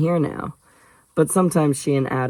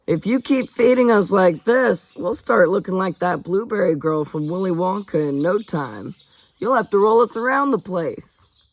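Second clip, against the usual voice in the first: higher-pitched, swooping character voice; jovial well-fed guest; 5 semitones higher than usual. Acted. Cam joking with the cook after a big meal, jovial and high. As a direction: tease the cook in a raised, lilting voice.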